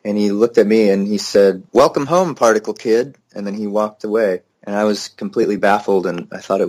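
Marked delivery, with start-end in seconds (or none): 1.7-3.2 a higher, hazy mock of a stoned dad's greeting. Dad welcomes his fourteen-year-old son home from school, in a lightly mocked higher, stoned voice. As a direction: lightly imitate a stoned father's welcome, pitched up. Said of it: "he was so stoned that it came out as [name]".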